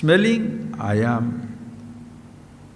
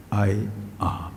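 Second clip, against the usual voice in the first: hushed whisper; 9 semitones lower, 8 dB quieter than usual. Acted. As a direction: drop to a soft, breathy whisper. Teacher sinks into hushed reverence.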